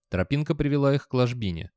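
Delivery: usual speaking voice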